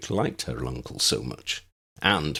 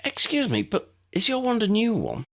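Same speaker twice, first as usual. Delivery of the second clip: put-on eager young woman. A narrator's reading. Lydia asks eagerly.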